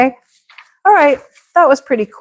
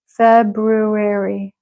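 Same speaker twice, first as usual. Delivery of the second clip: slow model word; much slower than usual. Shown as a demonstration. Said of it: "teacher models the word slowly".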